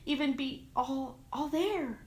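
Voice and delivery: lightly voiced mother's sob rising in pitch; mild tearful rise on all there; sobbing mother. A narrator's reading. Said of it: "she sobbed".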